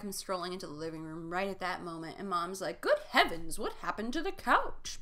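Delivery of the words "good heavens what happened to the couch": mock mom. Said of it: "doesn't know what parents sound like".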